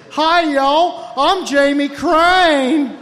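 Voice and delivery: mock the guy as a slurring Southern chef; Jamie Crane impression. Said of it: "The voice that he's talking about goes something like this".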